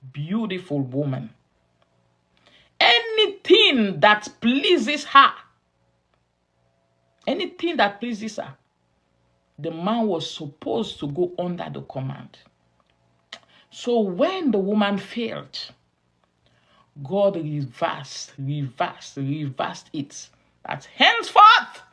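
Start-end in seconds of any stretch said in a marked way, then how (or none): 2.8-5.3 loud, high, slow preacher's emphasis; emphatic proclamation. Real, not acted. Preacher hammers the phrase, then repeats it. 20.7-21.8 loud, high-pitched pronouncement; proclaimed decree. Acted. Preacher proclaims God's new decree.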